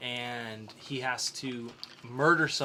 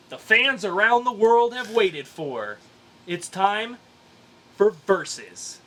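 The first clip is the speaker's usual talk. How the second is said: louder, higher show-host build; announcer hype; 7 semitones higher, 7 dB louder, slower than usual. Acted. Host announcing the versus segment. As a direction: sell it like a big event.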